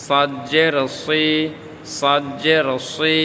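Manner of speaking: 'সাদ' and 'সি' with a heavy, rounded s; rounded-mouth heavy S. Shown as a demonstration. Teacher drills the letter, 'সি' heavy with rounded mouth. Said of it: "মুখ গোল করে".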